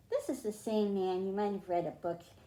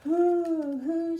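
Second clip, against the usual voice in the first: high, loud, long owl hoots; owl hooting; 8 semitones higher, 7 dB louder than usual. A narrator's reading. Story reader voices the owl for children, hooting. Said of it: "said the owl".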